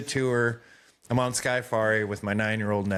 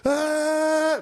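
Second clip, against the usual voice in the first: high sustained mock yell; imitated scream; 4 dB louder than usual. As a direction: hold a long, high singer-style scream. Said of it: "But he screams for".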